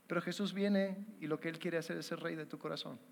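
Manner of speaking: ordinary delivery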